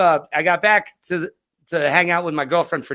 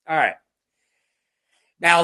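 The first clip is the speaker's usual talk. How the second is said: ordinary talk, nothing marked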